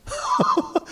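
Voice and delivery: brief high real laugh. Real, not acted. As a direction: laugh briefly after a wry remark. Preacher laughs after wry question. (짧게 터지는 높은 웃음)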